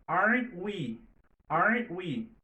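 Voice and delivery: "Aren't we" with the t held, not released; stop T. Shown as a demonstration. Teacher models the held T: "Aren't we" with the t stopped. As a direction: stop the t in "Aren't we" without letting it out. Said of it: "Toujours ou kenbe T a".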